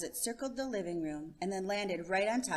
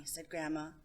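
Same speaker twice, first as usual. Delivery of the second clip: plain reading voice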